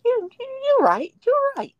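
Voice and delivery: very high, loud mock agreement; squeaky high agreement. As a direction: squeal it in fake modesty. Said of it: "I was like".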